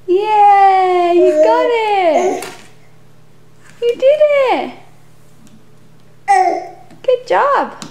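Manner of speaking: excited cheering. Real, not acted. Mum cheering her baby's first crawl. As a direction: cheer her on like she just won.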